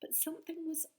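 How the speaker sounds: fast high whisper; suspenseful whisper. A narrator's reading. Narrator warning children something's wrong, whispered. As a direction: hush it like a secret.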